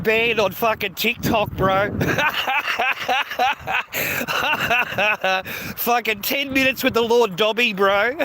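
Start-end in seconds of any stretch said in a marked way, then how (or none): 1.9-5.7 long rolling belly laugh; helpless run of chuckles; real laughter. Real, not acted. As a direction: crack up at your own story. Laughing at his own ban.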